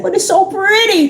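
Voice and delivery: high-pitched retold objection; pleading protest. She argues for keeping the pretty dress, high pleading protest. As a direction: protest up high against the order. Her protest against the Father.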